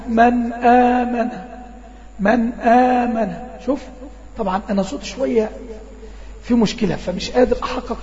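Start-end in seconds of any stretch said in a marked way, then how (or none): 0.1-1.3 slow drawn-out recitation of "من آمن"; Quran recitation demo. Shown as a demonstration. Teacher reciting "من آمن" for students, slow and drawn out. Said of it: "بعكس لما أقول لك". 2.2-3.4 slow drawn-out recitation of "من آمن"; Quran recitation demo. Shown as a demonstration. Teacher reciting "من آمن" for students, slow and drawn out. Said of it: "بعكس لما أقول لك".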